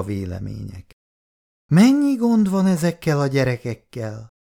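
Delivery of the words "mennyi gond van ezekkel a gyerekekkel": higher, louder weary sigh of complaint; sighing lament. A narrator's reading. Old aunt sighs to the family about troublesome children. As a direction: sigh out your worries over the kids. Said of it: "sóhajtott fel Szabó néni".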